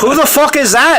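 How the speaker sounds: loud, high, hammed-up Irish accent. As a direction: bark it in an over-the-top Irish accent. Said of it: "interview you as Conor McGregor".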